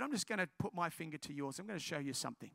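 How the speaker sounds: low, soft and quick; hushed, low retelling of a gentle offer; gentle reassurance. Retold or imitated. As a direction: quietly ease someone into it. Gently preparing girl for prayer.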